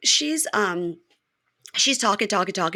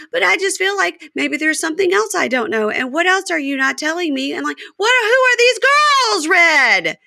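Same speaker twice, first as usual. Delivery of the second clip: much higher, rising suspicious whine; voicing Joy; 10 semitones higher than usual. Podcaster as Joy grilling Red, much higher. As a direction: climb into a shrill suspicious demand.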